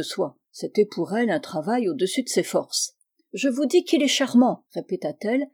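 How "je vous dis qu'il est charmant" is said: raised, insistent restatement; stubborn. A narrator's reading. Spinster refusing to explain herself, stubborn. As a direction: dig in like a balky mule. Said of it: "avec l'entêtement d'une mule butée".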